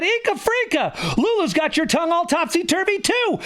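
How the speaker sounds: high-pitched, drawn-up cry of alarm; alarmed exclamation. A narrator's reading. Teacher accusing the principal of catching it, high and alarmed. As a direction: exclaim it high, pointing out the culprit.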